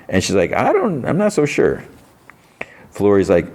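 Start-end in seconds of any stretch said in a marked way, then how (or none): 0.5-1.8 very high, hesitant mock doubt; wary doubt. She doubts the snapping eagle likes her, wary doubt. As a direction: imitate someone politely unconvinced.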